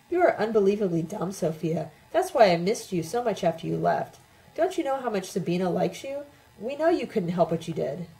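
usual speaking voice

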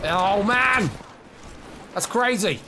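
loud, high outburst of disbelief; high-pitched gamer outburst; excited exclamation. Real, not acted. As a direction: cry out at a crazy play. Stunned reaction during play.

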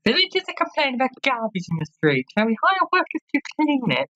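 high-pitched villager voice; high, put-on game character voice; townsperson petitioner. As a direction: put a request to the ruler in a high voice.

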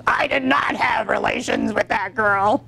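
hammed-up presidential denial; Bill Clinton. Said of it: "How about Bill Clinton?".

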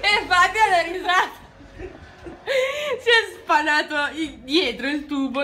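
can barely speak through laughter; laughing. Real, not acted. Laughing about flooded toilet.

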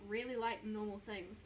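usual speaking voice

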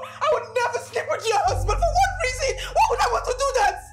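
pleading distress. Acted. Maid begs her employer through tears, high and pleading. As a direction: beg through tears, pitch climbing.

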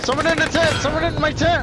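excited yelling. Real, not acted. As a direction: yell out the enemy spot. Enemy spotted in tent.

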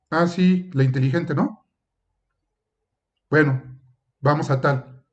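teacher demo of a sarcastic reply. Shown as a demonstration. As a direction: say it with a sly ironic edge. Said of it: "en el modo en cómo lo dije, ¿qué estoy comunicando?".

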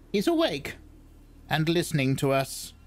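high, thin crone whisper-talk; a sly old witch noticing. Acted. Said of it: "said the old woman".

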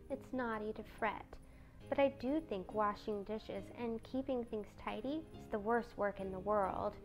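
soft, gentle little voice; small, hushed, mild fretting; timid Beth. A narrator's reading. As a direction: complain meekly and quietly.

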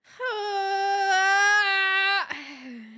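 long loud crying sigh; a loud despairing groan; a wailing loud sigh. Real, not acted. Despair over update.

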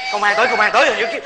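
ordinary delivery

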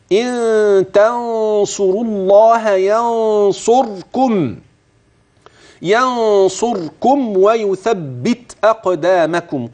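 solemn teaching recitation; Quranic recitation. Shown as a demonstration. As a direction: intone the verse slowly with full tajweed. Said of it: "انتبه لأماكن المد والغن".

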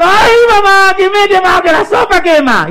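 very high, loud screaming complaint; hammed-up shriek of a burnt child; pained child's wail. María crying to mamá about burnt mouth, loud wail. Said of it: "Y me dijo".

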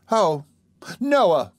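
high, drawn-out wounded protest; reproachful. A narrator's reading. Charlotte protesting to Noah who blames her, reproachful. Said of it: "cried Charlotte reproachfully".

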